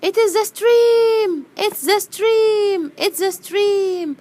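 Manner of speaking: very high, loud, stretched cries; happy, excited shouting. Acted. Bee spots the stream near home, excitedly. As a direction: squeal each repeat with joy. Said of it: "قالت وهي مبسوطة".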